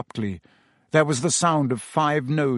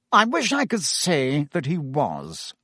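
ordinary delivery

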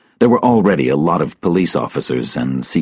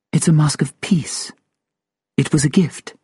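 high, measured woman's voice; woman character; 12 semitones higher than usual. Acted. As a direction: explain as the woman, pitched up. Sylvia replies in her character voice.

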